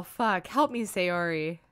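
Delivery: ordinary talk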